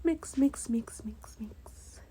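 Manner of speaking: trails off into whispered mix; fading to a whisper. Real, not acted. Fading mix chant while stirring.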